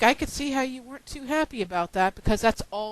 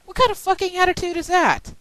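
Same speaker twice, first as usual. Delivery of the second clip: angry yell; 10 semitones higher, 5 dB louder than usual. Real, not acted. Ranting at negative commenter.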